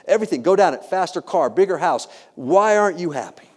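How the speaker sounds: worked-up scolding of oneself; exasperated self-reproach. Retold or imitated. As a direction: list it off, then demand an answer of yourself. Berating himself in retold self-talk.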